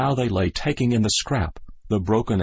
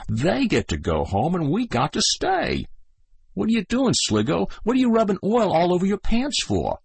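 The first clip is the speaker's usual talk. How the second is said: put-on higher, wondering kid soldier; puzzled young soldier; 11 semitones higher than usual. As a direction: ask like a confused kid. Kid's voice.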